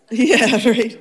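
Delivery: loud laugh spilling into yeah right. Real, not acted. Councilwoman cracking up at her joke about looking younger, laughing loudly. Laughs while praising colleague.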